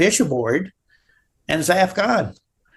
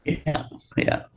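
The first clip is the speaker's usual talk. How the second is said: whispered yeah; 7 dB quieter than usual. Real, not acted. Whispered agreement aside.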